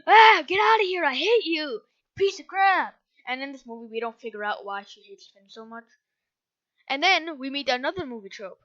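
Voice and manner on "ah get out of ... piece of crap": higher, louder hammed-up yelling; mock furious screech; angry ex-wife. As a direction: shriek it at the ex-husband. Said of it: "Ex-wife is like".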